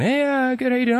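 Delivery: high, breezy morning-call chatter; casual phone greeting. Retold or imitated. Greeting his buddy on the morning phone call, high and casual. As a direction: chirp it up high like a friendly call.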